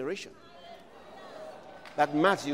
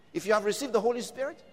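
ordinary talk, nothing marked

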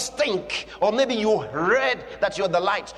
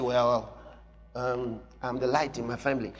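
lower, softer hesitant mumble; timid believer; 9 semitones lower, 6 dB quieter than usual. Pretend. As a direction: shrink the claim, unsure. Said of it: "So you say".

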